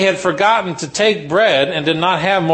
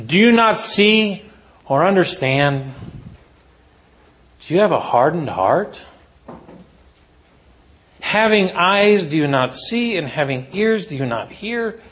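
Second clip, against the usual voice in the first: slow pleading questions; 2 semitones higher than usual. Retold or imitated. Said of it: "said to them".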